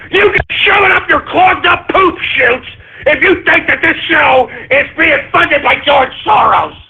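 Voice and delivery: high, angry yelling at the listeners; furious shout. Real, not acted. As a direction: blast it out in a rage. Host raging at Soros accusers.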